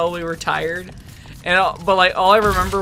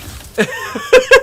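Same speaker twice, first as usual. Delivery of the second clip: high laugh; 7 dB louder than usual. Real, not acted. Laughing at friend's reply.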